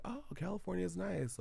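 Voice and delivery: lightly voiced friendly driver; imitating the driver. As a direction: pitch up into a cheerful chatty driver. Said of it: "He's like".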